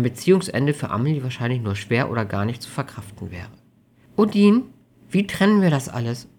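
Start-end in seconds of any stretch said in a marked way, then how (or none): 4.2-6.3 put-on higher, uncertain young woman; mildly worried question; unsure. A narrator's reading. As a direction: ask anxiously, raised in pitch. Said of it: "fragte Amelie unsicher".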